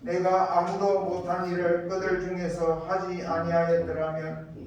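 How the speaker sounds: ordinary talk, nothing marked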